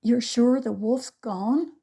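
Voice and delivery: frightened little brother asking; quivering voice. A narrator's reading. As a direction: ask it scared and unsure. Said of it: "voice a quiver".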